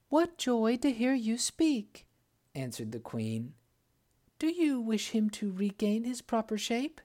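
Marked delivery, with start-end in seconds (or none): 0.1-2.1 high, slow, joyful queen voice; delighted queen. Acted. 4.4-7.0 higher, eager queen voice. Acted.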